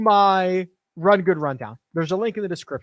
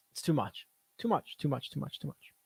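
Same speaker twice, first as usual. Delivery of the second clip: fading mutter; 4 semitones lower, 12 dB quieter than usual. Real, not acted. Repeating himself, trailing off.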